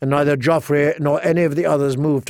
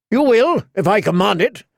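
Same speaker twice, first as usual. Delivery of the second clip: higher, sharp and insistent on the first words; petulant royal command; 7 semitones higher than usual. A narrator's reading. Joffrey ordering his uncle Tyrion, high and petulant. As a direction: snap a command like a spoiled king.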